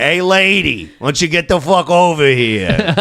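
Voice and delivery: crude New York Italian tough guy; mock Italian accent. Brash guy calling a lady over, mock New York Italian accent. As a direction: lay on a pushy Italian-American accent. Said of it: "That's the real Italian accent".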